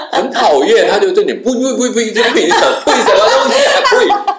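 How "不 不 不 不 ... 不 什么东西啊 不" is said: high, drawn-out mock camel refusal, laughing; be a sulky camel refusing everything; grumpy camel. Camel refuses the tourists, hammed-up high and laughing.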